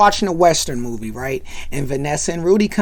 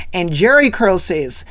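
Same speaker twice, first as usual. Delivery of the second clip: ordinary talk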